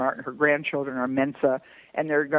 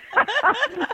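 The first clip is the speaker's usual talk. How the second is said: short high laugh burst; real laugh; 3 dB louder than usual. Real, not acted. Laughing at herself.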